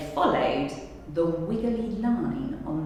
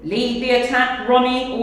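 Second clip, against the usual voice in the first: ordering; 3 semitones higher, 7 dB louder than usual. Acted. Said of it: "ordered Captain Crook".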